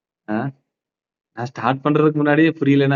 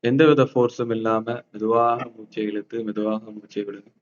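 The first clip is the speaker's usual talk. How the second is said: slow, soft breathing guide; soft spa whisper; 4 dB quieter, slower than usual. Acted. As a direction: hush it, guide each breath gently. Leads breathing exercise.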